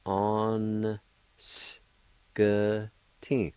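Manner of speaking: "On s k t" broken into separate sounds, the N joined to the first A; syllable by syllable. Shown as a demonstration. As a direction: sound out "On s k t" piece by piece. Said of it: "pronounce the N sound with the first A like this".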